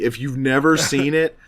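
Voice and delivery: ordinary delivery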